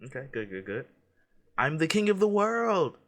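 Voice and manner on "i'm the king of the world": 1 triumphant shout. Acted. Quoting Titanic in quiz.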